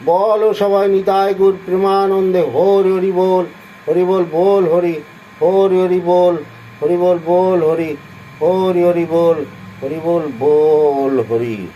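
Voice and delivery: chanted call of hari bol, slowing at the end; devotional call. Acted. Calling listeners to chant Hari, in rousing devotional chant. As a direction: rouse the listeners, then ease down slowly.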